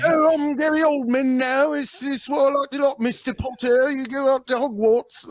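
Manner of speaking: high, put-on Cockney actor voice; Cockney accent. Guest mocking an actor with a Cockney accent. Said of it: "like, Cockney?".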